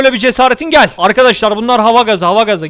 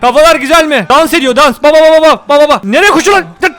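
excited yelling; 6 semitones higher, 5 dB louder than usual. Real, not acted. Watching enemies dance and run.

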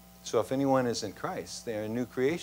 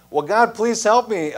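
higher and louder, begging; desperate plea; 8 semitones higher, 7 dB louder than usual. Acted. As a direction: plead for rescue in panic. Jack pleading with God.

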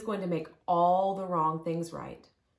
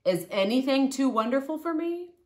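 God asking; 8 semitones higher than usual. A narrator's reading. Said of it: "God asked".

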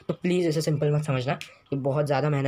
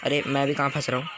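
ordinary talk

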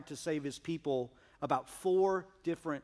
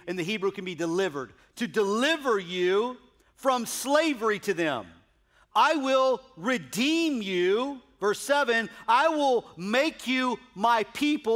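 preaching; 9 semitones higher, 7 dB louder than usual. Acted. Pastor urging the congregation about God's deliverance, full-force preaching. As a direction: preach the gospel with full conviction.

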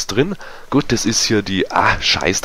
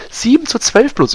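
a sudden high, loud cry at the score; dismayed surprise; 6 dB louder than usual. Real, not acted. Disappointed at the match score.

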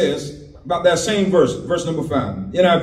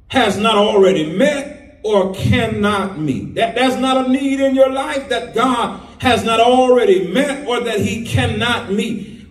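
ordinary delivery

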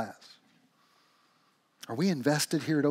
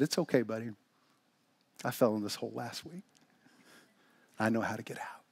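soft, lower, unhurried reply; calm reassurance; 4 semitones lower, 8 dB quieter than usual. Acted. Buddy reassures the trapped man, calm and quiet. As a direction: quietly settle a panicking friend. Friend calmly reassures panicking Bob.

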